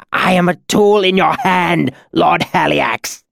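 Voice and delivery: high, loud, pained submission; agonized amendment. A narrator's reading. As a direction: cry out the full title in agony. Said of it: "Cinder amended as he crumpled".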